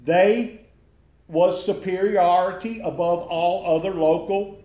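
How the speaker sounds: loud, emphatic speech for someone hard of hearing; hollering. Real, not acted. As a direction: holler it out so he can hear. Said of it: "you're hollering at Joe".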